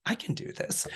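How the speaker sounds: hushed, unvoiced whisper to oneself; whispered thought. Thinking while watching a friend audition, secret whisper.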